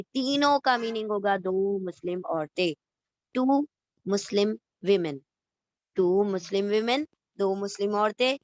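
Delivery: ordinary delivery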